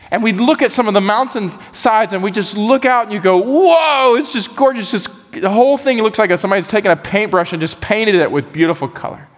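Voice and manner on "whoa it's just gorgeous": awed whoa. Retold or imitated. Awed at hillsides.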